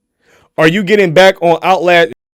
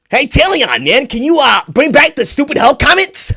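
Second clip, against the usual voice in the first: mimicking a fan; 9 semitones higher than usual. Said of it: "a lot of y'all been asking".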